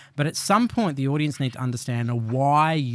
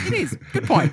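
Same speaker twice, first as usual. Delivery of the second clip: mock concession; 14 semitones higher than usual. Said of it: "I'm like".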